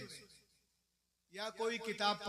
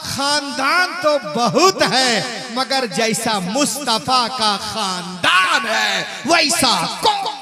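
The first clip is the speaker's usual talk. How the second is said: loud, slow, fervent pulpit shout; slow thundering pulpit cry; preaching shout; 3 semitones higher, 18 dB louder than usual. Acted. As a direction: raise the crowd to its feet. Sermon peak, shouting.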